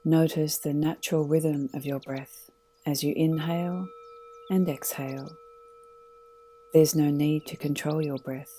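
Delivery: calm, unhurried meditation guide voice; guided meditation. Acted. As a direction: lead them gently into stillness.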